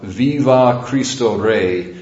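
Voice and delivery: slow, stretched-out mock cry of faith; retold martyr's shout. Priest echoing the martyr's cry to his congregation, slow mild proclaiming. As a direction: call it out slowly, a hint of defiance. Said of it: "shouted out".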